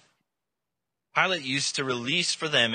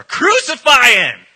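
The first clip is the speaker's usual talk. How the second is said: high, slow, full-force yell; very loud, stretched-out crowd cry; even fiercer mob shout; 13 semitones higher, 17 dB louder, slower than usual. Acted. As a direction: roar it out as a mob. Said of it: "they shouted all the more".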